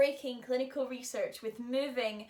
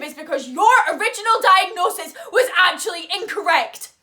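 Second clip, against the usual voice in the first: raised voice, climbing higher and louder into fury; angry outburst; 5 semitones higher, 14 dB louder than usual. Acted. Patient confronts Vivi over the failed drug treatment, angry outburst. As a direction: build to a loud, furious accusation.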